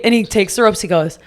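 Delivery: ordinary talk, nothing marked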